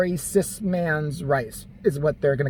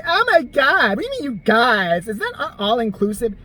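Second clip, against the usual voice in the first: mock offended woman, high and loud on Oh my God; hammed-up gasp of outrage; 4 semitones higher, 3 dB louder than usual. A woman mocked for taking offence at you guys, hammed-up and shrill-pitched. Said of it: "a woman might grab her breast and say".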